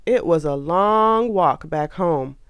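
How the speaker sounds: ordinary delivery, nothing marked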